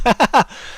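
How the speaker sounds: high, quick chuckle; easy high-pitched giggle; real laugh. Real, not acted. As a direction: burst of amused laughter. Greeting a viewer in chat.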